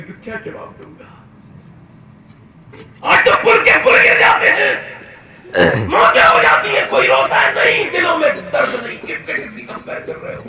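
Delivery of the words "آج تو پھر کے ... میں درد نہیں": high, loud impassioned sermon shout; preaching at full force. Acted. Preacher roaring to the congregation about Umar. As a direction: cry it out to the crowd.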